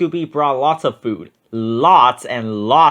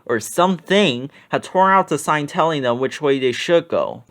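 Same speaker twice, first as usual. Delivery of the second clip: ordinary delivery, nothing marked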